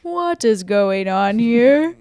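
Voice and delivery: slow, drawn-out mock bewilderment; exaggeratedly slow retold question; imitating Andrew. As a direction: stretch the question out, imitating him. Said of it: "And Andrew goes".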